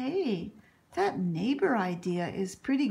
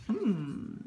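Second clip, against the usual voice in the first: plain reading voice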